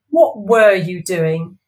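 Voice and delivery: loud, slow demand. Acted. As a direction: press him for an answer. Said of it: "Wendy demanded to know".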